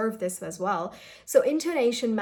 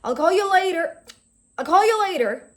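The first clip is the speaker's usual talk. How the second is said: "I'll call you later" higher and louder throughout; wrong raised version; 9 semitones higher, 8 dB louder than usual. Shown as a demonstration. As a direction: push it up high and loud, wrong for a statement. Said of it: "you won't be doing something like this".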